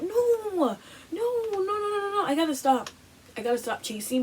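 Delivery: high, pleading string of no's; frantic no's. Retold or imitated. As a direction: shake it off, no no no. Quoted refusal, much higher.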